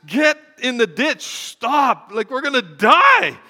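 loud, high, acted-out panic yell; hammed-up desperate shouting, building on the last word; inner panic shouted. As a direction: scream the alarm going off inside you. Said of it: "everything in me is like".

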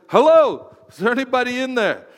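high, loud teasing call; mock calling out. Pastor mocking someone whose lights are off, loud teasing call. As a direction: knock on a dull head.

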